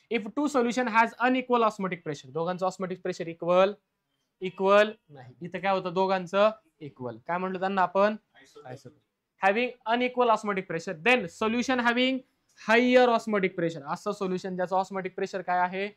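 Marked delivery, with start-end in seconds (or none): 0.1-2.3 dictating each word slowly for notes; slow dictation. Shown as a demonstration. Dictates definition to class. 9.4-13.8 dictating each word slowly for notes; slow dictation. Shown as a demonstration. Dictates definition to class.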